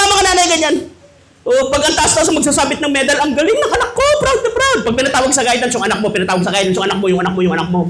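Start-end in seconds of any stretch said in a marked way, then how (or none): 3.2-4.8 very high, gushing and laughing; mock-proud mother squealing; boasting mother. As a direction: brag like a proud mum. Boasting mother at medal ceremony.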